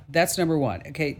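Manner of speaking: usual speaking voice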